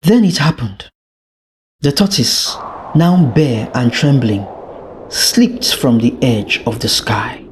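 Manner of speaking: ordinary delivery, nothing marked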